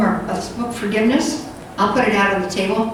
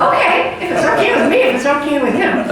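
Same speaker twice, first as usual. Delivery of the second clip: higher, amused, laughing through it; bemused laughing okay, pitched up; laughing reply; 5 semitones higher, faster than usual. Retold or imitated. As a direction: answer flustered, chuckling as you go. Teller retells her own flustered reply.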